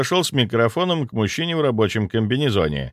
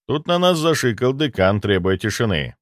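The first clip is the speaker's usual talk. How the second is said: ordinary delivery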